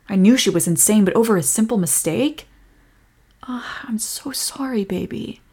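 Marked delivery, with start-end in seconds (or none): 3.4-5.4 slow whispered sympathy; tender whisper. Acted. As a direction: hush it out gently. Comforting upset partner.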